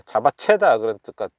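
usual speaking voice

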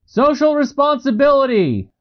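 high, projected reply to a crowd; called-out answer. A narrator's reading. Narrator answers yelling crowd.

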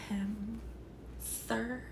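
hushed, disbelieving whisper at the screen; incredulous whisper. Real, not acted. Mocking the firefighter who wants to hose Michael, whispered. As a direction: whisper it like you can't believe him.

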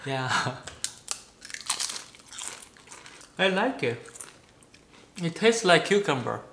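talking while chewing chip; eating snack mid-sentence; mouth full, chewing. Real, not acted. Seen 0: Man holds chip piece at mouth, jaw working, just ate chips.

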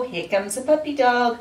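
normal talking voice

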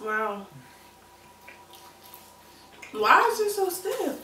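food in mouth; speaking between bites; chewing while talking. Real, not acted. Seen 1: both people hold food near mouths; faces small, speaker unsure.

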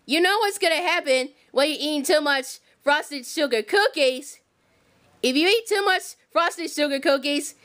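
deep motherly warning; Harriet voice. Pretend. Toy play, Harriet voiced low.

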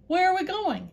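high, scared little donkey voice; frightened donkey asking the men. A narrator's reading. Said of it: "He said to the men".

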